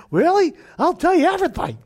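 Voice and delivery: high, loud mocking eager voice; mock eager pastor. Mocking the pastor who falls for the rich caller, high and eager. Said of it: "he doesn't speak like that".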